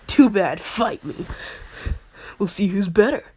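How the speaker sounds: loud, brash demand to fight; pushy shout. Retold or imitated. Hothead insisting she fight him, loud and pushy. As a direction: bark the dare and refuse to back down. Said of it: "Inosuke personality".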